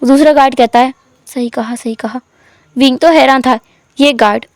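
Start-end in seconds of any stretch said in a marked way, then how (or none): 1.2-2.2 much softer, lower murmur; guard murmuring agreement; quiet agreement. A narrator's reading. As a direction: mutter agreement softly.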